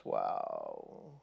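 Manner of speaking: breathy, awed whisper of a word; whispered wow. Real, not acted. Pastor reacting to the psalm's sarcasm, whispered awe. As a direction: let it out under your breath in awe.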